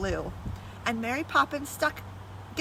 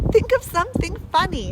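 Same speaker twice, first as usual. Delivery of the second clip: a bit louder and higher, eager plea; children crying out; 6 semitones higher, 5 dB louder than usual. A narrator's reading. Children urging Mary Poppins to laugh, lightly raised voice. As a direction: call it out to her, coaxing. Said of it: "cried the children".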